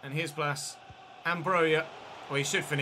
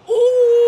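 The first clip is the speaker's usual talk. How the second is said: loud, high Geordie-free gasp of excitement, much higher; a sharp, much louder ooh as the ball hits the post; excited ooh; 17 dB louder than usual. Real, not acted. Gamer reacting as his striker scores: excited loud ooh. Near miss then goal.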